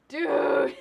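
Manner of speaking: loud sharp outburst; exasperated shout. Real, not acted. Gamer shouts at the game in exasperation, loud short outburst. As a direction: snap it in disbelief.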